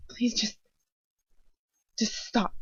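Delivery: soft, drained begging; faint plea. Acted. Exhausted captive begs her torturer, soft and broken. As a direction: barely get the words out.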